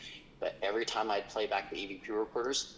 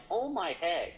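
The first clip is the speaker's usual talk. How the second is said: astonished; 9 semitones higher, slower than usual. Retold or imitated. Speaker's astonished reaction.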